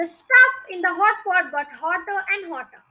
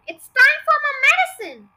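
high, loud call through a wall; from another room; 8 semitones higher, 8 dB louder than usual. A narrator's reading. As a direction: shout it from the next room. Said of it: "the awful voice from the next room".

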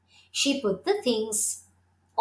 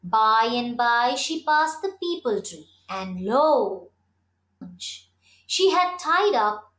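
ordinary delivery

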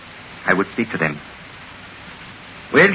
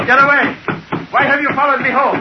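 yelling; 12 semitones higher than usual. Acted. Simon yelling at Lindley who followed him home. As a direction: shout him off in a frenzy. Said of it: "Stop yelling!".

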